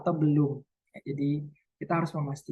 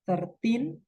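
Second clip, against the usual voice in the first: put-on thirteen pitched high with a rising teen; stress on TEEN; 6 semitones higher than usual. Shown as a demonstration. Teacher contrasts with thirty, stress on teen. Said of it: "penekannya di tin".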